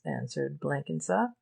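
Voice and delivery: ordinary delivery, nothing marked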